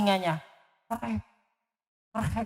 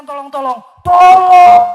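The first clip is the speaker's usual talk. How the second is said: desperate cries; 7 semitones higher, 12 dB louder than usual. Acted. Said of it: "Panjang dia terikan".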